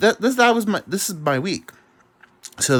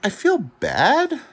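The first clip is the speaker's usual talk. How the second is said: mock whiny voice; 13 semitones higher, 3 dB louder, much slower than usual. Mocking voice in quoted stripper talk.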